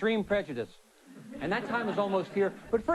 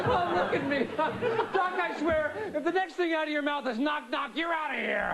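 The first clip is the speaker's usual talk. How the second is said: laughing fit; 6 semitones higher than usual. Acted. Host still cracking up at joke.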